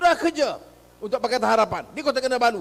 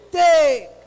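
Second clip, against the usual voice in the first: loud, high, single-word shout; emphatic call; 6 semitones higher, 7 dB louder than usual. Real, not acted. Speaker tells the crowd to take the money, shouting. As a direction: punch it out to the crowd.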